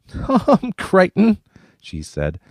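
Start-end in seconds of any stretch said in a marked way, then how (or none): 0.1-1.4 very high, slow, still laughing; mock Tom through the laughter; laughing teasing. A narrator's reading. Kate teasing Tom while still laughing. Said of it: "Kate howled with laughter".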